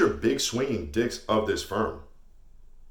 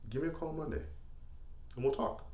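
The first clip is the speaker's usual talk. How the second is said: much softer, controlled sign-off; quiet, unhurried closing threat; 3 semitones higher, 14 dB quieter than usual. Acted. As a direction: drop the volume and let it land. Quiet closing warning to recruits.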